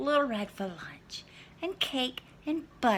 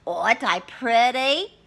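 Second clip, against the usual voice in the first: Granny; 4 semitones higher, 9 dB louder than usual. Acted. Granny vain.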